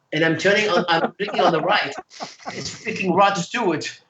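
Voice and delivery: usual speaking voice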